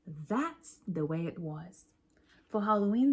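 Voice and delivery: usual speaking voice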